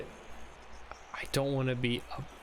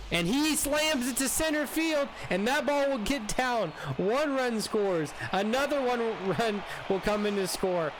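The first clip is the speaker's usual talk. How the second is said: live play-by-play; 6 semitones higher than usual. Acted. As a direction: call the hit like a broadcaster.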